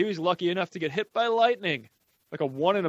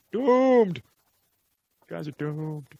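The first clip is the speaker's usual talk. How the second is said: doom prophet; slower than usual. Retold or imitated. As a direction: shout it, then sink to a murmur. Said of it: "while he just goes".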